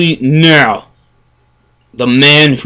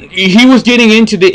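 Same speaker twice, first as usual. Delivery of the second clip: ordinary talk, nothing marked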